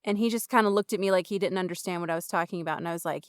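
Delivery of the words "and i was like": ordinary talk, nothing marked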